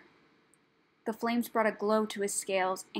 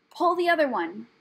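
high, loud scoff; huffy, raised-voice dismissal; scornful; 5 semitones higher, 6 dB louder than usual. A narrator's reading. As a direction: scoff at a feeble excuse. Rat scoffs at excuse.

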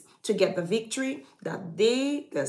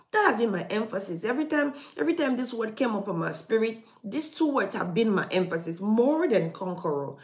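normal talking voice

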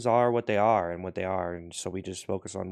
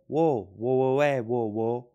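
whoa calls; 2 semitones higher, 4 dB louder, slower than usual. Acted. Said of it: "And you go".

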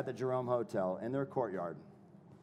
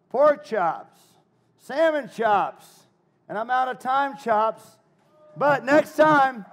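loud, high stage-host call; showman hype; 10 semitones higher, 12 dB louder, slower than usual. Real, not acted. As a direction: punch out each phrase to the crowd. Hypes the crowd as host.

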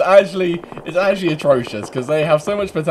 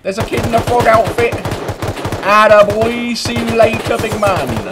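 high, raised yelling; shouted callouts; 10 semitones higher, 7 dB louder than usual. Pretend. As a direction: holler across the field at them. Keeps yelling as the chase ends.

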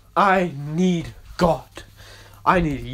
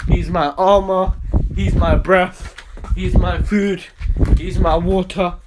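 ordinary talk

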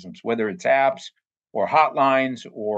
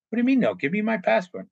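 indignant protest; 9 semitones higher, faster than usual. Retold or imitated. Worker protesting to his manager, higher and indignant. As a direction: demand it back, disbelieving and higher.

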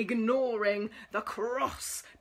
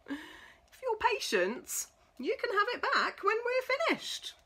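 teasing monkey; 11 semitones higher than usual. Acted. Monkeys teasing the cross pig in a much higher cheeky voice. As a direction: tease the pig from up in the tree.

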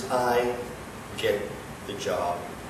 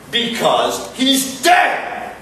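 high, slow, shouted words; 8 semitones higher, much slower than usual. Acted. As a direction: lean into every word. Boss shouts at employee.

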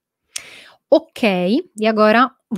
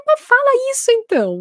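excited retort; 5 dB louder than usual. Retold or imitated. Retold reply to a student.

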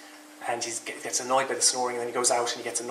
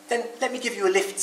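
higher-pitched, lively offer; kindly bear character; 9 semitones higher than usual. A narrator's reading. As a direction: offer it brightly as a storybook bear. Bear offers Rabbit a ride.

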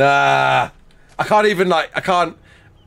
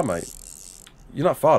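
normal talking voice

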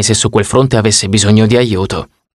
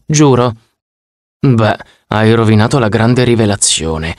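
ordinary delivery

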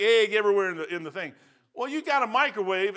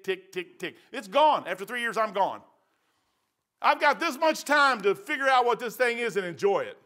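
ordinary talk

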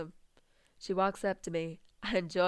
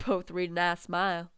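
usual speaking voice

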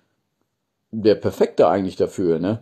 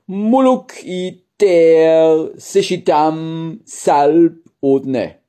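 slow, drawn-out reversed sentence; backwards speech; 7 semitones higher, 4 dB louder, much slower than usual. Shown as a demonstration. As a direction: sound out the reversed sentence slowly. Satz rückwärts vorgeführt. (langsam rückwärts gesprochener Satz)

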